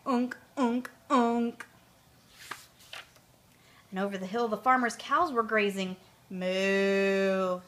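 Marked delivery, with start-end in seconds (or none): 0.1-1.7 horn notes. Acted. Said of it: "and first he played". 6.3-7.6 cow moo. Acted. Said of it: "said one".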